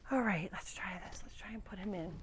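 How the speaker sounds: hushed whisper; soft unvoiced whisper. Real, not acted. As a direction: whisper so the goat can sleep. Settling the goat to sleep.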